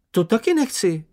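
high, loud frightened cry; cried out. Acted. Sniff refusing to go first, crying out high. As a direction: yelp it in alarm. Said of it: "vykřikl Čenich".

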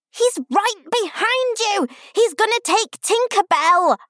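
put-on high, loud little-girl yell; soaring yell. A narrator's reading. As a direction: yell it out like an over-excited child. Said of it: "Pip yelled, her voice soaring".

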